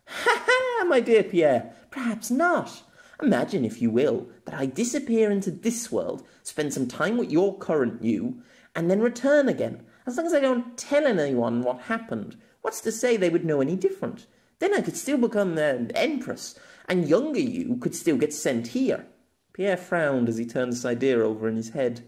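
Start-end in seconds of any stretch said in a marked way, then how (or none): 0.1-0.8 high, bright laugh; Maka laughing. A narrator's reading. Maka laughing at Pierre's paradox, high and amused. 0.9-19.1 put-on higher, eager young woman, sharp and clever; Maka. A narrator's reading. Maka quizzing Pierre about being isekai'd, higher and eager.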